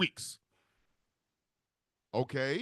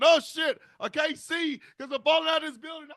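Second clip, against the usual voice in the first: much higher, panicked yelp; falling scream; 10 semitones higher, 3 dB louder than usual. Retold or imitated. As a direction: cry out mid-fall in mock panic. Voicing a falling victim.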